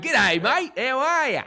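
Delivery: exaggerated Aussie twang, pitched very high; loud, hammed-up mock Australian accent; [name]. Imitating English friend back from the rodeo, exaggerated Aussie twang. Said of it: "She came back with an Aussie twang".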